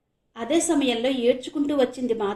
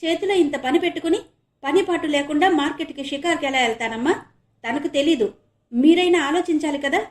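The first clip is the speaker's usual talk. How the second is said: irritated; 6 semitones higher, 6 dB louder than usual. Acted. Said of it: "చిరాగ్గా అన్నాడు ఆనంద్".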